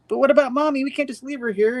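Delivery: hammed-up anxious children; child pleading.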